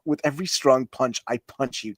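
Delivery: normal talking voice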